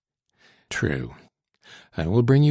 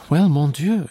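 higher and louder, an eager outburst; exclaimed; 7 semitones higher, 5 dB louder than usual. A narrator's reading. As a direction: toss it out with lively surprise. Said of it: "she exclaimed".

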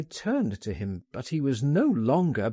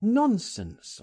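dismissive cry; 9 semitones higher than usual. Acted. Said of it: "I cried".

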